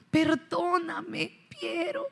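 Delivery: put-on slow, soft little-girl plea fading away; a hint of a sorry little girl; pleading apology. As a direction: beg forgiveness very slowly and meekly. Girl begs friend's forgiveness.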